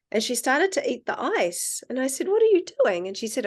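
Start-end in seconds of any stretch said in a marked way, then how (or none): 2.3-3.1 high, louder mock-startled question; higher-pitched, puzzled challenge; retold surprised question. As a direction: re-enact a taken-aback parent questioning a child. Mother reacting to child eating ice.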